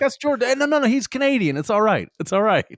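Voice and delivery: high, loud acted reassurance; urgent vouching. Friends vouching for Canadian Jordan to a stranger, high loud insistence. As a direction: call out to calm the objectors down.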